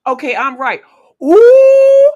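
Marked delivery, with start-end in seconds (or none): 1.2-2.1 loud, high, held "ooh"; triumphant whoop. Real, not acted. Host hearing she is right, triumphant high whoop. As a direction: let out a long triumphant whoop.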